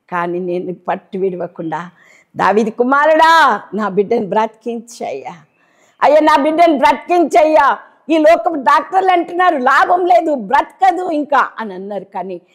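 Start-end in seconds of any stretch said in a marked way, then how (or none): 2.4-11.5 put-on a mother's loud, rising prayer for her child; desperate plea. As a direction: plead with God for her daughter's life. (బిడ్డ కోసం తల్లి గట్టిగా వేడుకోవడం)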